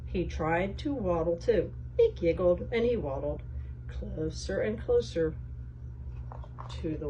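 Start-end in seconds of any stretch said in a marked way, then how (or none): none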